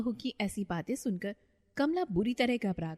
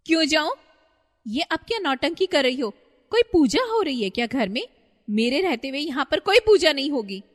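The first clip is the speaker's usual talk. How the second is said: loud, higher defiant daughter-in-law; defiant shout; 6 semitones higher, 9 dB louder than usual. Acted. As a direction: yell it, refusing the puja. Daughter-in-law defies the puja.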